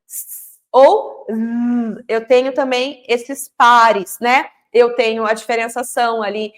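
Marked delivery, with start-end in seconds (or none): 0.1-0.6 long hissed sss in place of "S"; "S" as a drawn-out voiceless hiss; letter sound S. Shown as a demonstration. Teacher showing parents the S sound, long hiss. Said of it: "eu vou ensinar F, V, S". 1.3-2.0 long buzzed zzz in place of "Z"; "Z" as a sustained voiced buzz; letter sound Z. Shown as a demonstration. Teacher contrasting Z with S, voiced buzz. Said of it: "que é a mesma coisa".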